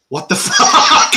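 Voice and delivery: drawn-out, slow word breaking into laughter; laughing disbelief. Retold or imitated. Host retells watching Greg get beaten, laughing in disbelief. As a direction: stretch it out and laugh through it. Retold reaction to the video.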